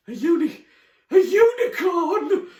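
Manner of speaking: very high, loud, drawn-out squeal; stammer, then shriek it in disbelief; astonished outburst. Acted. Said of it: "finally managed to say".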